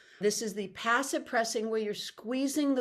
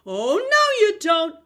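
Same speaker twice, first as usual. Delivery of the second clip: high, loud playful calls; 9 semitones higher, 11 dB louder, slower than usual. Shown as a demonstration. Teacher playing a calling dialogue for fun. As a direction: call out a silly back-and-forth. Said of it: "have so much fun with it".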